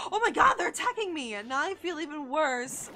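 loud, high, distressed yelp; panicked protest at being attacked; alarmed outcry. Real, not acted. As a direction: gasp and cry out in guilty alarm. Game creatures start attacking her.

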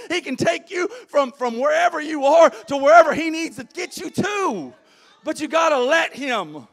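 loud, high, rousing preacher's call; full-force preaching. Acted. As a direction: preach it out to the congregation.

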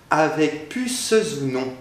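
"puces ou" linked as pu-ce-zou, the E and the S both sounded; liaison made. Shown as a demonstration. Teacher showing students the right liaison, "avec puces ou non" linked. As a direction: join "puces" to "ou" with a Z, pu-ce-z-ou. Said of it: "faire la liaison".

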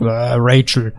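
creepy superhero growl parody; mock brooding masked hero; Batman. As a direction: put on a hammed-up dark vigilante voice. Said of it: "creepy things that happen to my voice".

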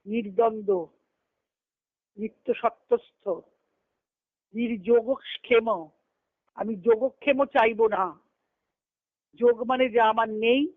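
ordinary talk

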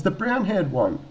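high, loud, chirpy caller impression; policewoman on phone. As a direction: imitate a woman announcing herself on the phone. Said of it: "She goes".

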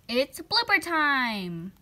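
loud, slow drawn-out announcement; announcing. Real, not acted. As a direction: stretch it like a host calling the show. Announcing the bloopers.

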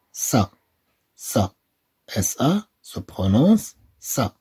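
slow syllable drill, "ce" said clearly; slow sound drill. Shown as a demonstration. Teacher drilling French syllables with pupils, slow. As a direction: model each syllable slowly.

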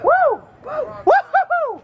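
high joyous woo-hoo; excited whoop. Real, not acted. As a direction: cheer like you just won. Celebrating a tip.

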